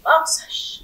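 dramatic storyteller whisper. Acted. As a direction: breathe the word like a secret. Hushed word in the tale.

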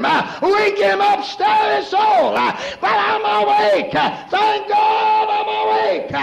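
loud, high, slow climactic shouting; preaching climax. Acted. Preacher cries out to the church at the climax, full force. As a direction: let it ring out as the peak of the sermon.

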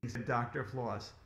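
usual speaking voice

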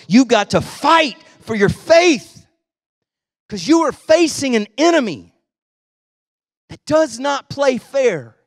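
loud, building sermon delivery; full-force pulpit preaching; fiery preaching. Acted. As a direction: preach it hard to the congregation.

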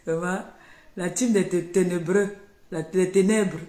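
taunting the "team des ténébreux" on her live, loud and jeering; loud mockery. Real, not acted. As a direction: scoff at them loudly. Mocking rivals on her live.

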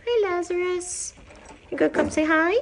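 pet talk. Real, not acted. Calling shelter cat Lazarus to come over, in high pet talk. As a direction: call him over in a high coaxing lilt.

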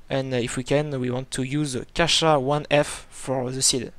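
slow radio call in heavy French-accented English. Real, not acted. Pilot calling Dublin Tower for start-up, French-accented English. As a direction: read the clearance request in English with a French accent. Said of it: "mon accent, euh, anglais pittoresque".

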